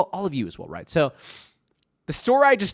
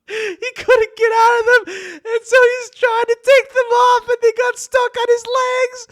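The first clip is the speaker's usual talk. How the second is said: squealing high, cracking up mid-story; real uncontrollable laughter; 5 dB louder than usual. Real, not acted. Podcaster telling friend's tight-pants bathroom mishap, laughing uncontrollably. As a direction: fight helpless laughter, voice pushed way up high. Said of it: "I'm hard as laughing".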